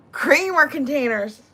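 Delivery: usual speaking voice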